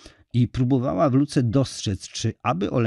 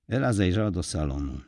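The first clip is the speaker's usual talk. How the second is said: ordinary delivery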